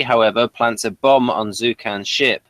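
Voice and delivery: normal talking voice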